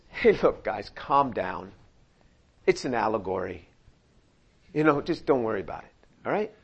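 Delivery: a soothing hint of calm down, guys; mock calm Jesus. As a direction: wave it off gently like it's nothing. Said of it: "You would think that he would say that".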